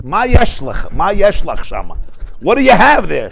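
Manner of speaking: woman behind her asking, exasperated; exasperated question. Retold or imitated. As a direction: demand what she has. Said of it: "She says".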